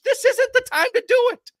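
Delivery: furious high-pitched tirade; furious outburst. Real, not acted. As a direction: let it rip at full fury. Peak of the rant.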